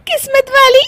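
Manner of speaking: high giggled echo; squealing laughter around the words; laughing. Acted. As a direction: repeat it in disbelief, then crack up. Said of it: "हंस क्यों रही हो".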